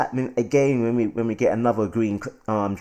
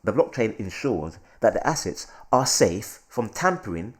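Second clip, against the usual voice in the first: ordinary delivery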